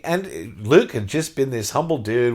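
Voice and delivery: ordinary talk, nothing marked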